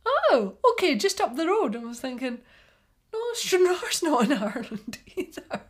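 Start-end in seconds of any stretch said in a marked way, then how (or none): 0.1-1.7 imitated realisation. Local man learning her hometown, imitated in loud, high realisation. 3.1-5.6 high, loud objection breaking into laughter; amused retold protest. Woman silently correcting local man's geography, retold as laughing protest.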